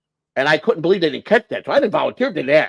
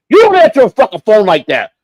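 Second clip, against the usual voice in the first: loud, higher barked order; angry colonel; 5 semitones higher, 6 dB louder than usual. Retold or imitated. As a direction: snap it at her like an angry husband.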